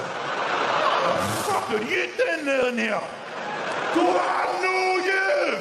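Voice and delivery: slow, high, bellowed Scottish fury; mental Scottish rant. Acted. Woken homeowner raging at intruder, deranged Scottish bellow. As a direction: go mental, drawing out each shout in Scots. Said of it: "a completely naked Scottish man".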